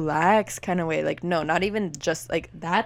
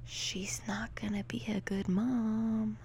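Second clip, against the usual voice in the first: slow hushed whisper; 3 semitones higher, 10 dB quieter, slower than usual. Real, not acted. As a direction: whisper the hot take slowly. Hot take said hushed.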